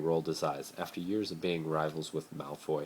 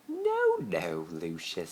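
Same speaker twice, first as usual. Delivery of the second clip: woman's voice; 3 dB louder, much slower than usual. A narrator's reading. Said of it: "said a woman's voice".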